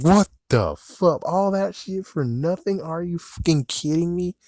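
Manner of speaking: can't believe it happened; outraged disbelief. Real, not acted. Outraged reaction to the ending.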